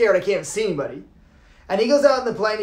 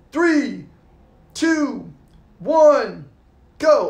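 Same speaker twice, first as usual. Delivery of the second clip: coach's countdown; 3 semitones higher, much slower than usual. Retold or imitated. Coach counting a jumper out of the plane, building to a call. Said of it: "the coach is in the doorway and goes".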